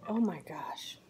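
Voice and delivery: whispered, low and soft; hushed awe. Real, not acted. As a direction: breathe it out in food bliss. Awed reaction to tasting food.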